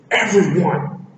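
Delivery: explosive acted shout; yelled outburst. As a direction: yell it out, exasperated. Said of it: "he yells out".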